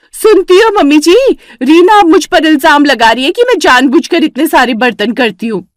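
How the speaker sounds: tattling yell across the house; calling out loud. Acted. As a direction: shout for the mother-in-law to hear. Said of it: "Vimla इतनी तेज चिल्लाई".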